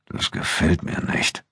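whispered and slow; whispering a warning to André while following soldiers. A narrator's reading. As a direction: breathe it out under the soldiers' noses. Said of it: "flüsterte Abu Dun".

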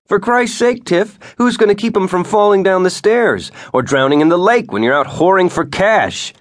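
husband shouting at his wife in a bitter fight; angry yell. A narrator's reading. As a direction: hurl the accusation at her.

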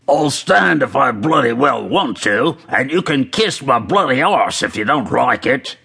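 put-on voice of a quarrelling fan; defiant soccer fan. A narrator's reading. Fan answers the man behind him, acted defiance. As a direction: talk back as a defiant British fan.

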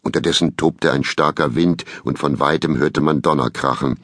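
ordinary delivery

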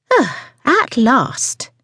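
high, slow, drawn-out complaint; impatient customer. Acted. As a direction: make the long wait felt. Impatient customer when waitress returns.

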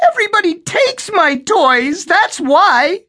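put-on small boy wailing in protest; wailing little boy. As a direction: whine it like a hurt small child. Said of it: "little Eddie wailed".

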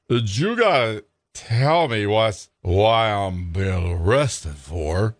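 put-on slow, heavy drunken drawl; slow drunk slur. Drunk Freddie asks the cop why he's arrested, slow slurring. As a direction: drag each word out like a drunk man.

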